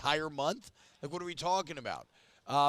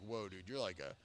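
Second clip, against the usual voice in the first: low, soft retold amazement; hushed awe; 6 semitones lower, 7 dB quieter than usual. Sports host retelling his reaction to a colleague's real job, in hushed awe. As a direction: drop the voice, quietly taken aback.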